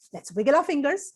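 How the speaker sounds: plain reading voice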